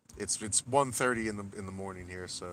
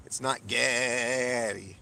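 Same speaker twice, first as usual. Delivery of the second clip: "It's not Getty", very slow, weight on "not"; slow insistent correction; 2 semitones higher, 5 dB louder, much slower than usual. Shown as a demonstration. Streamer firmly telling chat the name isn't Getty, very slow. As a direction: spell it out slowly and firmly.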